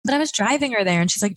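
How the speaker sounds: ordinary talk, nothing marked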